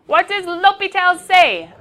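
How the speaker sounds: high, loud and slow; slow quiz question. Shown as a demonstration. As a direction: draw the question out for the class. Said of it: "quizzing the class".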